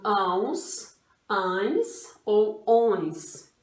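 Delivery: "ãos, ães ou ons" stretched, each nasal ending kept distinct; sounded-out endings. Shown as a demonstration. Teacher telling pupils to listen to the sound, sounded-out endings "ãos, ães ou ons". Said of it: "prestar atenção no, no som".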